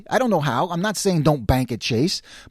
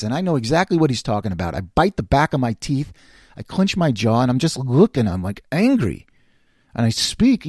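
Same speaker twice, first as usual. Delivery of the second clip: usual speaking voice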